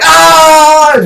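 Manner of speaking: sudden loud high yelp of laughter; startled laugh. Retold or imitated. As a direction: burst out with a shocked laugh. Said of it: "he starts laughing".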